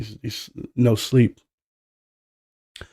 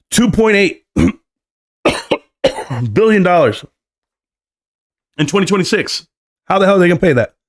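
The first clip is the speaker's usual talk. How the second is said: higher, incredulous at the number; stunned disbelief; 8 semitones higher than usual. Real, not acted. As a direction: react like the figure is absurd. Astonished at huge debt figure.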